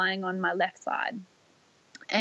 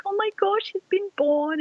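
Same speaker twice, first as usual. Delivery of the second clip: high, thrilled exclamation; mock-excited high voice; excited sisters; 12 semitones higher than usual. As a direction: squeal the news with joy. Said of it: "they were like".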